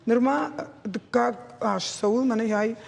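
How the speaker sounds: usual speaking voice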